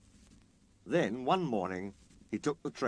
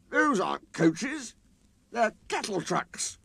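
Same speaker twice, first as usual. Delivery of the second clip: disgusted engine; 11 semitones higher, 4 dB louder, slower than usual. Acted.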